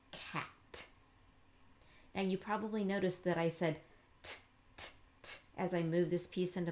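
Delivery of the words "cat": "Cat." breathed out softly, sounds blended; modelled blend. Shown as a demonstration. Modelling blending for the learner, soft and slow. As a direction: blend "Cat." smoothly, barely voiced. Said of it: "And model blending for them".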